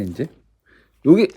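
normal talking voice

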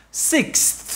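"Six" ending in a crisp breathy KS; released ks. Shown as a demonstration. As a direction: push the KS out at the end of "Six". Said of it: "bung được cái âm K S". (bật rõ âm KS cuối "Six")

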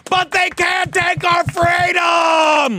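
high, loud, slow rallying cry; mock heroic speech. To cheering co-hosts, hammed-up freedom speech. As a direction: proclaim it like a movie hero rallying troops.

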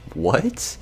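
high, rising mock-stunned What?; a sudden disbelieving squeak. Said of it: "I was just like".

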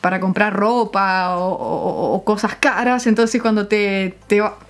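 normal talking voice

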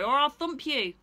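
bully him for apples; bossy kangaroo. Acted. Kangaroo demands apples from Stephen, loud and bossy.

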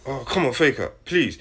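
ordinary talk

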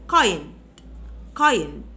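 kind said loudly and low; loud drill. Shown as a demonstration. Teacher drilling the word for students. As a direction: call out kind firmly. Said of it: "teacher drills the word".

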